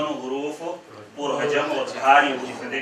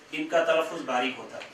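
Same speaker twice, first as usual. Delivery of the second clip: ordinary talk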